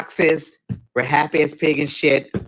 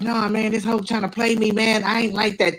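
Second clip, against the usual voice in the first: higher, riled-up insisting; worked up; 8 semitones higher than usual. Retold or imitated. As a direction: dig in, pitch raised. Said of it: "I saw somebody completely different".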